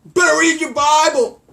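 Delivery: high, loud, slow whiny voice; hammed-up bratty whine; mocking whiny kid. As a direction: whine it like a spoiled brat.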